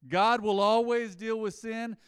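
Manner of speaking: slow, loud, high proclamation; booming sermon point; emphatic restatement. Real, not acted. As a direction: proclaim it to the whole room. Preacher restating his main point.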